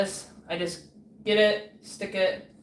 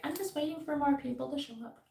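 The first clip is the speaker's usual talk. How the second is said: mocking others; 7 semitones higher, 8 dB quieter than usual. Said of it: "people are just like".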